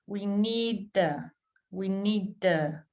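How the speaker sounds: slowly running need and to together; slow blending demo. Shown as a demonstration. Teacher slowly showing learners the blend. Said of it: "notice how we have blending here".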